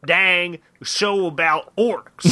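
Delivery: slow, laughing Southern drawl; Southern accent. Retold or imitated. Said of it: "Southern accent bit".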